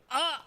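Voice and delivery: sudden high "ah"; high squeal. Real, not acted. Streamer reacting to the bashful girl in the game, high squeal. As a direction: let out a short, high-pitched squeal.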